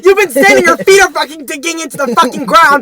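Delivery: furious shouting rant; angry rant. Retold or imitated. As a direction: yell at the idiot in line. Ranting about slow line.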